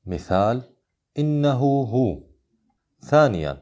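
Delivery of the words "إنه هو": the "ه" in "إنه هو" lengthened to a long oo; higher-pitched "إنه هو", drawing out the pronoun's oo; short connecting madd. Shown as a demonstration. Teacher models the minor connection rule, "إنه" with lengthened final oo. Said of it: "ومقدار مده حركتان".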